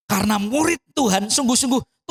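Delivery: ordinary talk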